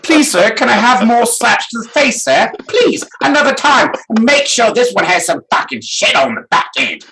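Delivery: high, pleading mock beggar; begging mock voice. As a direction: beg like a groveling servant. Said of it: "I was like".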